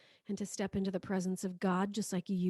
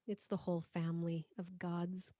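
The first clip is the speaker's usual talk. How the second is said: usual speaking voice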